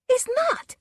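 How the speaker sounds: very high, loud yell. A narrator's reading. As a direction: snap back with a yell. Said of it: "Susan yelled".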